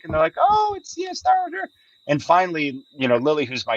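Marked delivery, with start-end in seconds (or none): 0.4-1.7 high, unimpressed daughter voice; dad imitating his girls' lukewarm verdict, pitched way up; mock flat daughters. Daughters shrugging off the movie, high mock voice.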